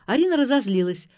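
blurting out. A narrator's reading. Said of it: "ляпнула Ирка и тут же захлопнула рот".

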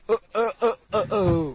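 jerky, high "uh" bursts; repeated staccato grunts, close to laughing; jerky noises. Shown as a demonstration. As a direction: imitate something stuttering along in jerks. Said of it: "It's going".

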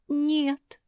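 high, plaintive moan; moaned refusal. A narrator's reading. Марсия refusing to let go of the branch, moaning. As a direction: moan it in fear. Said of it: "простонала Марсия".

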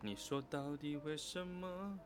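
ordinary talk, nothing marked

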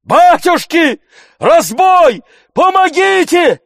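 very high, loud alarmed yells; crying for help. A narrator's reading. Tarantyev crying robbery, screaming for help. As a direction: scream for help in separate bursts. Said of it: "кричал Тарантьев".

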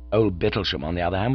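ordinary delivery, nothing marked

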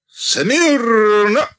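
high, loud parade command; shouted command. A narrator's reading. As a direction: bark the order to the ranks. Commander addressing regiment.

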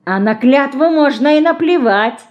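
boy slyly mocking her vow; sly smirk. A narrator's reading. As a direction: toss it off with a sneer. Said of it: "с хитрой усмешкой сказал он".

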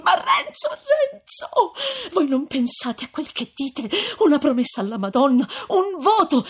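high, loud distressed appeal; agitated female character, voice soaring; horrified pleading. A narrator's reading. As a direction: plead with shocked urgency. Lucia begs Renzo to think.

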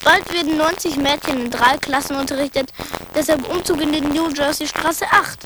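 ordinary delivery

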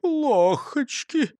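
high, drawn-out voiced near-whisper; hushed dismay. A narrator's reading. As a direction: stretch it high in a small dismayed voice. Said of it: "прошептал Густав Гейер".

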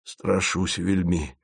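slow, soft and pleading; plaintive. A narrator's reading. Asen confesses his fear to the travellers, plaintive. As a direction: whimper out the fear. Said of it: "жалобно сказал Асен".

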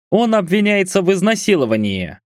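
officer delivering bad news; police officer. A narrator's reading. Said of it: "огорошил нас офицер".

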